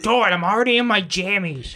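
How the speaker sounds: slow, dragged-out mock whine; bad roommate. Roommate refusing to come out in pajamas, imitated slow whine. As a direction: imitate a reluctant friend dragging out his excuse. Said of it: "doing the Marshall fucking bad roommate voice".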